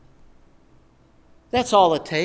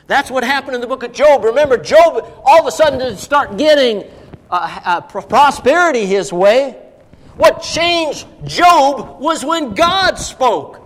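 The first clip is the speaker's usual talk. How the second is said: loud, raised preaching voice; full-force preaching; 5 semitones higher, 7 dB louder than usual. Real, not acted. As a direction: drive the point home to the congregation.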